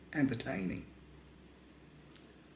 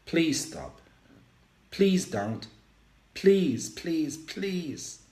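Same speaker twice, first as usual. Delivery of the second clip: tearful pleading; 8 semitones higher, much slower than usual. Acted. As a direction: beg her to stop, voice cracking upward. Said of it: "I plead my plea with tears in my eyes".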